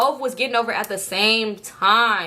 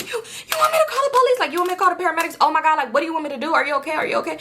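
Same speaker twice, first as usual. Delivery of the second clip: high-pitched, stammering questions; acted hysterical fretting over someone hurt; frantic panic; 7 semitones higher, faster than usual. Crying driver to pregnant woman she hit, frantic. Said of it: "I was going crazy".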